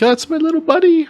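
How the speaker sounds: put-on very high, fast baby-talk coo; doting squeaky voice. Death Guard marine cooing over his Nurgling buddy, squeaky high voice. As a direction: coo it like cuddling a pet.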